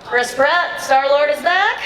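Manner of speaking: crowd-pumping host call; hype announce. Real, not acted. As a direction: announce him like a ringmaster.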